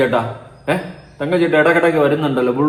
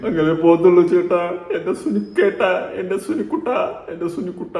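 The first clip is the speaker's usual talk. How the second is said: mock tearful whimper; 4 semitones higher than usual. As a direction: whimper the pet name in fake tears, trailing away. Mimics the tearful clip just played. (കളിയാക്കിയുള്ള വിതുമ്പൽ)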